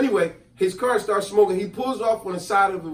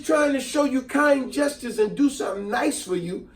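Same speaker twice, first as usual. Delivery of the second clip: retelling how he told off his buddy; upset scolding; 5 semitones higher than usual. Retold or imitated. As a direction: lecture him like you're fed up. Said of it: "I was so upset with him".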